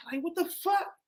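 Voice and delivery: high, disbelieving exclamation; incredulous outburst. Real, not acted. To his friends about the cops, high incredulous exclamation.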